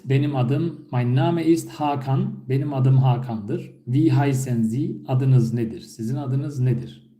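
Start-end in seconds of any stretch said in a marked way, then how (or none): 0.9-2.4 Mein Name ist said slowly and clearly; slow model phrase. Shown as a demonstration. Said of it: "bu da bir soru tipi". (yavaş ve net örnek cümle)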